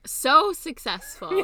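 high, slow sarcastic drawl. Real, not acted. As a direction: mockingly stretch it out. Sarcastic echo of successful podcasters.

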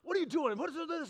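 a dad barking at his son, voice pushed up; mock gruff father scolding. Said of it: "gruffy guy".

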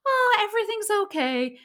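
high, light imitation of a carefree heroine; airy-fairy. Film heroine soothing a ball of tension, airy-fairy. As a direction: float it out high, without a care. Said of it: "so airy-fairy and light".

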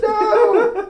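mock scream. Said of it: "Everybody's just".

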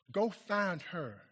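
ordinary talk, nothing marked

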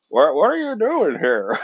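lightly imitating the old-movie character's surprised question; Jimmy Stewart impression. Friend quoting the cricket's line like an old movie star, lightly mimicked. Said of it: "he sounded like Jimmy Stewart".

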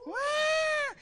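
high little cub cry; baby lion wail. Retold or imitated. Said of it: "the little babies are".

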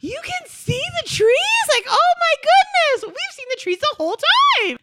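hammed-up wonder at seeing trees; overwhelmed amazement. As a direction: gasp it out like seeing colour for the first time. Colorblind people seeing colours first time.

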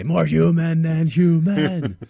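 slow, loud, gravelly rock growl; [name] impression. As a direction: drag it out like a metal frontman. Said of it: "That's my [name]".

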